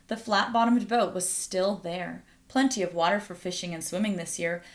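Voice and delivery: plain reading voice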